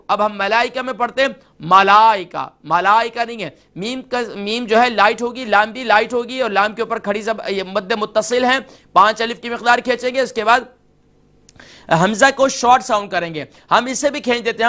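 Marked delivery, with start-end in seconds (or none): none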